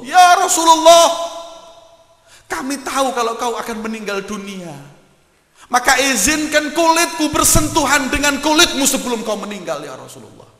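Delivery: very high, loud emotional wail; tearful outcry. Acted. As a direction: cry it out, overwhelmed with love. Ukasha embraces the Prophet's back. (ratapan haru yang keras)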